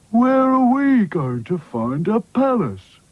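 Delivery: slow, gloomy doll voice; Sad Sack's voice. Acted. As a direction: drag it out gloomily. Sad Sack speaks.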